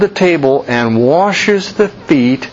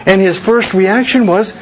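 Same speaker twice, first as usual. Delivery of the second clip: ordinary delivery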